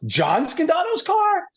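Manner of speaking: high, drawn-out disbelief; slow, high mock amazement; astonished question. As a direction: imitate a starstruck double-check, pitch soaring. Mechanic's amazed reaction, retold.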